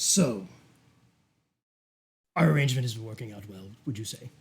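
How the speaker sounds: lightly voiced sinister duke. Duke checking on his arrangement with Bridget, lightly sinister. Game master plays the Duke.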